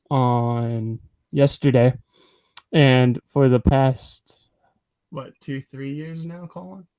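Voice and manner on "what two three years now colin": much softer, turned away to ask; quiet aside. Real, not acted. Checking with Colin off-mic, very quietly. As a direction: drop to a quick muttered side question.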